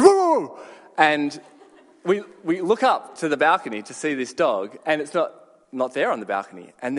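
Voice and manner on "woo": sudden high loud mock dog woof; dog's bark imitated. Dog barking from a balcony at passers-by, loud mock woof. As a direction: bark it out like the dog above you. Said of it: "you hear the".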